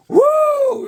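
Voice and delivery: very high, loud "woo"; sudden piercing mock whoop; mimicked whoop. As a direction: whoop like an over-excited stranger. Imitating a man in the bathroom.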